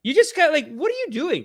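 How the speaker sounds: normal talking voice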